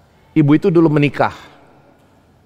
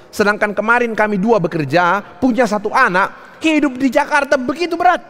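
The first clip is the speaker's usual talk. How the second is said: high, insistent imitation of an overwhelmed mother; retold desperate plea; 12 semitones higher than usual. Abandoned mother confiding her struggle to pastor, retold desperate plea. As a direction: pour out the burden at a high pitch, acted.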